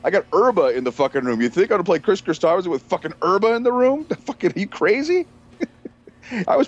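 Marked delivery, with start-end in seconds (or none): 0.0-5.2 high, rapid-fire disbelief climbing higher; exasperated rant. Retold or imitated. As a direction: ask as if he has lost his mind. Acted rant at a music fan.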